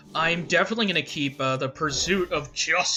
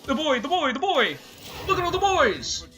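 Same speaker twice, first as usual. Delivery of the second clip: excited squeal; 11 semitones higher than usual. Real, not acted. Gleeful over summoned minions.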